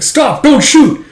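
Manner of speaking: very high, loud yell; urgent shout. Acted. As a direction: scream to stop the archer. Stopping archers.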